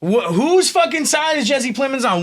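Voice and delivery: high, incredulous questions; baffled disbelief. Retold or imitated. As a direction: sound utterly thrown by it. Retelling his own baffled reaction.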